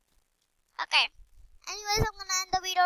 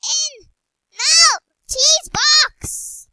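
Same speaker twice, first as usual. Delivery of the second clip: high, slow drawn-out yell; long, loud exasperated no; frustrated yell; 10 semitones higher, 9 dB louder, much slower than usual. Real, not acted. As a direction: scream at the stubborn cheese box. Yelling at the game character.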